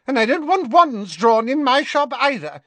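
a panicky seamstress blurting; hasty Madame Malkin. Acted. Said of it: "she added hastily".

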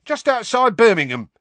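high cheery Cockney conductor; chirpy, loud Cockney bus conductor; happy. A narrator's reading. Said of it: "said Stan happily".

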